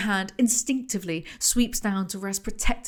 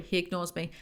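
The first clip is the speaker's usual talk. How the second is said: usual speaking voice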